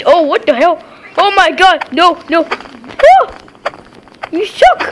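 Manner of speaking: loud, high gamer panic; real outburst, jumping high and loud; alarmed yelling. Real, not acted. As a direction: yell in dismay at the game. Yelling at the game.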